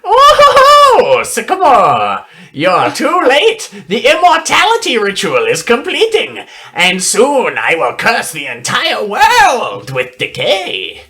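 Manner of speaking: high, loud cackling villain; sneering gloating dark voice. Acted. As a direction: gloat and cackle like an evil necromancer.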